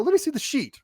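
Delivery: high-pitched disbelieving demand; retold incredulous demand. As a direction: act out demanding proof, voice up high.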